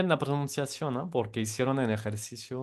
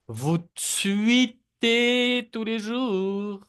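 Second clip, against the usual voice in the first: higher and louder, tweetez drawn out slowly; exaggerated slow model; 10 semitones higher, 6 dB louder, much slower than usual. Shown as a demonstration. Teacher exaggerates the sentence for students to copy. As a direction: sing out tweetez slowly for the class. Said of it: "repiten las frases también".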